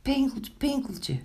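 high, frightened storybook call; anxious calling. A narrator's reading. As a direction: call the name high in worry. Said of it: "riepen de muisjes angstig". (angstig en hoog roepend)

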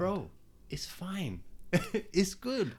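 imitating himself, slow and airy, telling a friend not to worry; mock reassuring bro. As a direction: brush it off like a chill friend. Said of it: "And I'm like".

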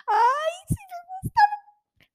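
high, slow, whiny mock cry; hammed-up crying craving; mock whimper. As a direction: whimper with pretend longing for the burgers. Longing for In-N-Out burgers.

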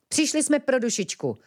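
high-pitched, sudden blurt. A narrator's reading. Ventilek tells Platón why they came, blurting it out. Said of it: "vyhrkl ventilek".

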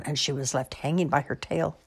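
ordinary delivery

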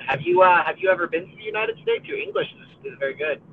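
ordinary delivery

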